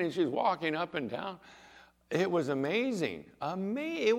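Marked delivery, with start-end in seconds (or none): none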